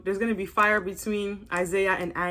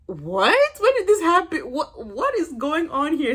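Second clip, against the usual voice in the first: higher-pitched baffled outburst; confused; 5 semitones higher than usual. Retold or imitated. Vlogger reliving her reaction at the TV, baffled. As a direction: relive utter confusion, slowing at the end. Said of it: "Because I was so confused".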